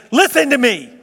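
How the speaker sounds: put-on high, loud "Listen to me." like a parent over running kids; parent calling kids. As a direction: snap the kids to attention. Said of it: "you say".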